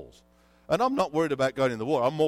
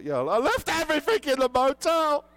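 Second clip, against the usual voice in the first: high, loud self-exasperation; exasperated admission; 12 semitones higher, 6 dB louder than usual. Retold or imitated. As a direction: throw up your hands at yourself. Exasperated admission of forgetting everything.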